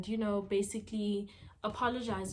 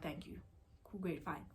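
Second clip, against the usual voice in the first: soft, low mumbled thank you; quiet thanks; 6 semitones lower, 13 dB quieter than usual. Retold or imitated. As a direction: murmur it under your breath. Quiet thanks after trust.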